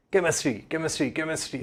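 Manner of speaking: repeating word like a mountain echo; cave echo. Retold or imitated. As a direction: echo the word fading softly. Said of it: "ऐसे सुनाई दे रहा होगा आपको".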